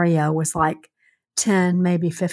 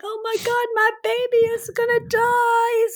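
high, frantic retold panic; replaying her own terror, pitched way up; a mother panicking over her baby in a hot car; panicked. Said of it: "I was like".

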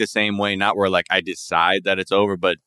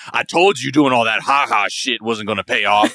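a put-on scornful partner voice, a bit raised; mocking unsupportive partner; 3 semitones higher, 4 dB louder than usual. Comedian mimics a doubting partner, mock scornful. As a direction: sneer it like a partner who never believed in you. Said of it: "someone who's gonna be like".

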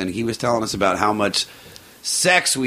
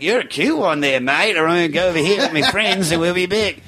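higher-pitched, badly faked Australian accent; botched Australian accent; 7 semitones higher than usual. Woman flirting with him before rejoining her friends, botched Australian accent. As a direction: mimic her with a poor Australian twang.

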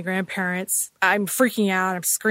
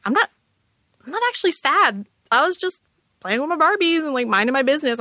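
lightly voiced little girl, pitched higher, insisting she is not sad; a hint of her childhood self pleading to be believed; half-acted child voice protesting to grandma, only lightly higher; young child protesting; 7 semitones higher, faster than usual.

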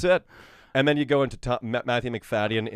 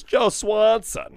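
Warburton voice; 10 semitones higher, 7 dB louder, much slower than usual. Retold or imitated. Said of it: "Patrick Warburton".

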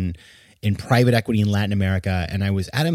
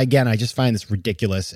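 usual speaking voice

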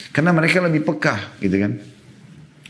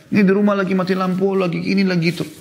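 complaining wife; 7 semitones higher than usual. Retold or imitated. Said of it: "ibu lagi telepon suami".

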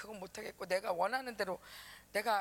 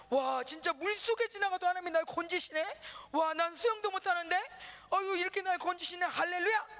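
rising praise; 6 semitones higher than usual. Real, not acted. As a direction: lift it up in praise. Preaching rises in praise. (높고 크게 찬양하듯 설교)

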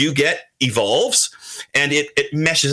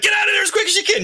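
high-pitched, rapid retold warning; hurried mock yell; urgent warning; faster than usual. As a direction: quote a friend urging a quick escape, pitched way up. Retelling Dent's urgent advice.

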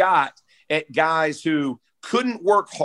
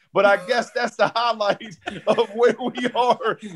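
laughing; 7 semitones higher than usual. Real, not acted. Laughing at the Xbox story.

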